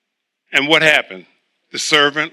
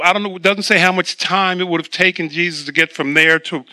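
usual speaking voice

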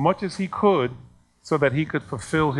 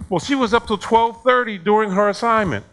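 retold angry complaint; 8 semitones higher, 5 dB louder than usual. Acted complaint of angry parent.

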